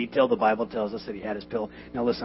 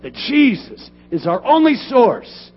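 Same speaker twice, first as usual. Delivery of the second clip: loud, high, slow preaching; full-force preaching; 11 semitones higher, 13 dB louder, slower than usual. Acted. Pastor preaching to his congregation, loud and slow. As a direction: thunder the point home to the church.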